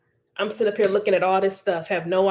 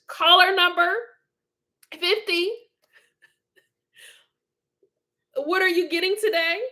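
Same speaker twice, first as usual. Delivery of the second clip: higher, playful sales operator voice; shopping-channel operator; 9 semitones higher than usual. Pretend. Pretending to take a grill order, shopping-channel operator. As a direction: play the phone-order host, amused.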